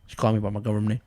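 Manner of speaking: normal talking voice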